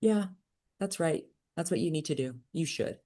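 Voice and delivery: soft, matter-of-fact mother; mother in the car, softly siding with God; calm mom agreeing. Retold or imitated. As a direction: say it quietly, like a settled verdict. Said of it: "And she said".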